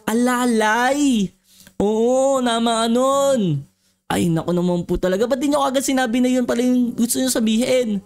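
ordinary delivery, nothing marked

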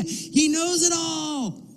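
very high, drawn-out cry; emphatic exclamation. Real, not acted. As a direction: stretch it out high as the climax of the point. Preacher's climactic emphatic exclamation.

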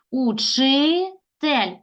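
"учитель" very slowly as учи, pause, тель; divided for hyphenation. Shown as a demonstration. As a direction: stretch учи, then set тель apart. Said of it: "Лише так можемо перенести".

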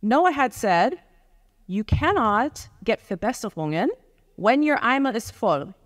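ordinary talk

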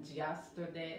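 YESTERDAY starting with a J, "jesterday". Shown as a demonstration. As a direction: say YESTERDAY with teeth together on the Y. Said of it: "juntan los dientes, dicen yesterday".

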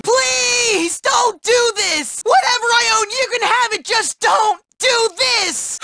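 high-pitched, frantic begging; desperate plea. Acted. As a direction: beg the bully for mercy. Begging the bully to stop.

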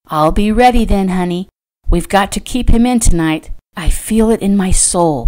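ordinary delivery, nothing marked

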